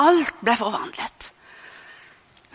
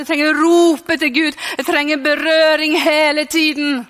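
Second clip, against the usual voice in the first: raised, insistent preaching voice; fervent preaching; 3 semitones higher, 3 dB louder than usual. Real, not acted. Preacher telling the congregation how much they need God's touch, fervently. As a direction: drive each repeated need home with force.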